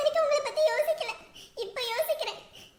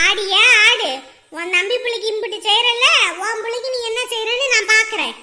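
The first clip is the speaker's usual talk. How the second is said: loud, low taunting challenge; angry taunt; 3 semitones lower, 8 dB louder than usual. Acted. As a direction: throw it at her as a threat. Quarrel with mother-in-law. (கோபமான சவால்)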